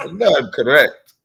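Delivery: ordinary talk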